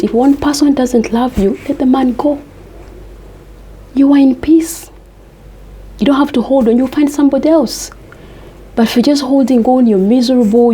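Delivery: normal talking voice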